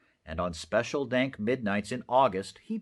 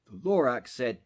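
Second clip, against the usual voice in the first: usual speaking voice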